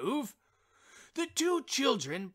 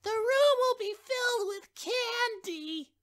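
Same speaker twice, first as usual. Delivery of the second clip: put-on much higher, eager childlike voice; delighted child; 13 semitones higher than usual. A narrator's reading. Child announcing the candy wish, high and thrilled. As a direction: promise it like a thrilled little kid. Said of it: "cried the child".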